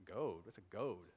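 very low, soft baffled aside; quiet, low-pitched confusion; mock puzzlement. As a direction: query the odd word under your breath. Baffled hearer queries the odd word.

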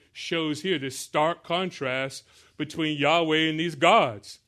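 ordinary delivery

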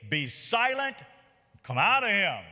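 mildly acted stern order; rebuke. A narrator's reading. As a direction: read it out as a commanding rebuke. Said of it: "But Jesus rebuked him".

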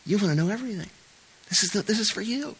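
soft, high, coaxing tempter; tempting Satan. Acted. Satan luring Eve in a soft high coax. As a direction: lure them in quietly. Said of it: "Satan, saying".

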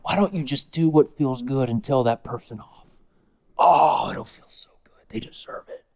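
low, soft egging-on whisper; Satan urging an angry person to lash out; whispering tempter. Acted. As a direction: hiss the bait softly, nearly silent.

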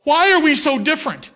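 high, loud preacher's cry; shouting. Acted. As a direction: cry it out to the congregation. Preacher shouts rhetorical question.

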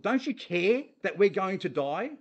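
fearful plea. Acted. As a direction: beg him to care. Frightened disciples' plea.

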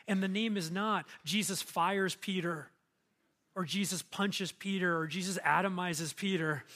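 normal talking voice

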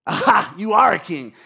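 loud, gloating gotcha; sarcastic. Acted. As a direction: pounce on it mockingly, at full voice. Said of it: "anger and defensiveness and sarcasm".

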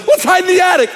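put-on high-pitched scared teen voice; mock panicky teen whisper-shout; horror movie teen. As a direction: squeak it like a nervous teen in a slasher film. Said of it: "one person ... says".

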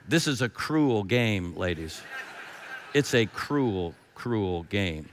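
low, slow and hushed; mock grave. Real, not acted. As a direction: drop low and draw out each "cruel". Mock complaint to the ladies.

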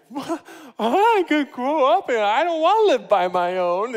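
put-on high, loud, teary little boy's wail; tearful four-year-old. Four-year-old son wailing to his dad at the table. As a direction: play the small son about to cry. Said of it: "he started to tear up".

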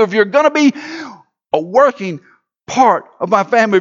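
high, loud preacher's cadence; fervent sermon delivery; full-force preaching. Acted. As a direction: preach it out to the whole room. Pastor voicing God's promise.

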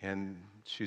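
usual speaking voice

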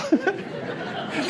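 soft airy laugh at his own joke; amused little breath laugh; breathy chuckle. Real, not acted. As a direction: let a quiet breathy laugh out.

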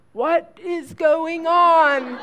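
loud, slow, laughing what-is-going-on; husband confused on the phone; baffled husband. Retold or imitated. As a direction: stretch it out in bewildered disbelief.

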